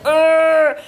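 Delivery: high, loud mock straining grunt; playful straining "urgh"; imitated effort grunt. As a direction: imitate a toddler heaving at something. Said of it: "she's like".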